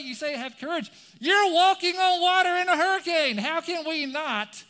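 high, full-volume exasperated outburst; incredulous yelling. Acted. Disciple protests walking on water in a hurricane, incredulous yell. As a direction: build to a shout of disbelief.

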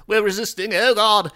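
high, loud wail of terror; hammed-up yelling while fending someone off; losing badly and crying out; mock panicked resister.

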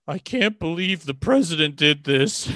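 hammed-up teary late-night host; weepy old TV host. Mocking a late-night host crying on national TV, weepy. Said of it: "bleary-eyed sixty-year-old man being like".

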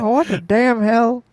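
slow Texan drawl, exasperated; Hank Hill impression. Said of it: "he sounds like Hank".

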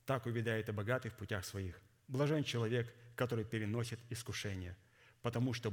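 ordinary talk, nothing marked

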